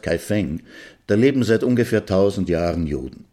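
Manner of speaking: normal talking voice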